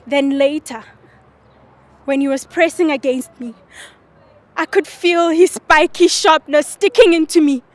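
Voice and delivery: deliberate dramatic recital with long pauses; monologue performance. Acted. Student performing a monologue on request for the host, dramatic recital. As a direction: perform it as a stage monologue, punching key phrases. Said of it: "Try one, one of the lines".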